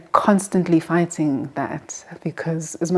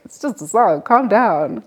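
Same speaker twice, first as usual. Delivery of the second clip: lightly exasperated, higher-pitched quote; mock exasperation; 4 semitones higher, 4 dB louder than usual. Younger self to crying church aunties, mildly exasperated. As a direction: imitate a baffled youngster, pitch lifted.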